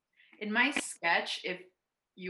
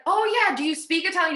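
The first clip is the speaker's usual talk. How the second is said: excited retelling; 12 semitones higher, 10 dB louder, much faster than usual. Retold or imitated. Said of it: "And he was like".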